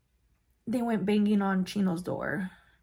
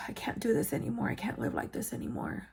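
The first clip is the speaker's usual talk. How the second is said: softer, quickly dropping to a near-whisper; retold weary despair; 6 dB quieter than usual. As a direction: recall giving up, voice sinking away. Recalls giving up after the beating.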